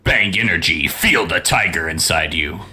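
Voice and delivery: low announcer-style tagline; mock ad slogan. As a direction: drop low for a fake sponsor plug. Mock sponsor slogan read.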